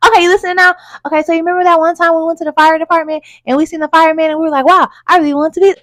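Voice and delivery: put-on high, eager, fast childlike chatter; an excited kid tugging your sleeve; inner child voice. Inner child reminding the listener of a firefighter dream, high and eager. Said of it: "It's almost as if it's like".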